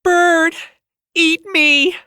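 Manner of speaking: high, loud frenzied fan yell; screaming fan. Acted. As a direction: scream it at your idol across the tarmac. Cheering fans at the airport.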